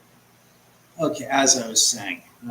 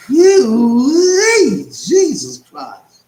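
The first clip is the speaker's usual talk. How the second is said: a shrill yelp bursting out, much louder and higher; sudden high squawk; 12 semitones higher, 12 dB louder, much slower than usual. Real, not acted. Streamer's voice suddenly cracks into a loud yelp on stream. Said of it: "What the hell was that?".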